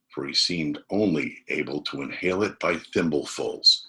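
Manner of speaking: ordinary delivery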